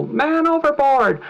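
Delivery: high, loud, urgent cry for help; desperate high yell of a fellow passenger; panicked yell. Retold or imitated. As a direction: shout it across the deck in alarm. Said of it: "hear one of your fellow passengers yell".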